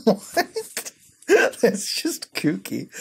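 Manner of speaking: high, laughing what; giggling, incredulous; laughing disbelief. Real, not acted. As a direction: crack up in disbelief.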